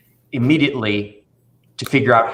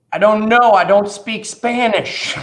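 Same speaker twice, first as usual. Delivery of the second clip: put-on high, louder little-boy voice; imitating a child; 9 semitones higher, 4 dB louder than usual. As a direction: blurt it like an innocent seven-year-old. Retells the child's reply.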